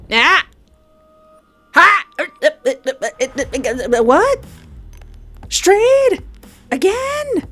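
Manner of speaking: loud, high startled cries of disbelief; shocked outburst. Real, not acted. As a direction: yelp each word in stunned surprise. Revived unexpectedly in game.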